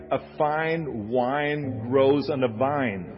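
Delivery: "A fine wine grows on a vine" drawn out slowly, each f, w and v carefully formed; slow, deliberate articulation of "fine", "wine" and "vine"; accent-class drill line. Shown as a demonstration. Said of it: "saying lines like".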